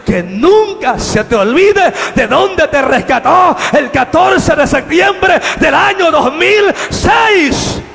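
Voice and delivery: the angel's message, growing into a slow shout; building shout. Retold or imitated. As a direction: build each word until you're shouting. Angel's message, rising to climax.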